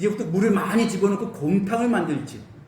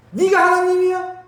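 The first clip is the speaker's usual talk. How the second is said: God's rebuke; 8 semitones higher, 5 dB louder than usual. Retold or imitated. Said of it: "하나님의 책망이 그거예요".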